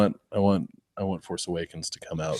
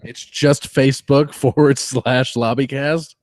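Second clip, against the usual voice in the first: incredulous; 5 semitones higher, 7 dB louder, slower than usual. Real, not acted. Incredulous at missing dot com.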